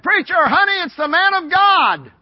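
high, loud announcing call; hollering. Retold or imitated. Wife calls out the visitor.

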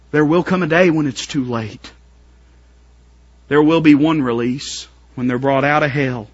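normal talking voice

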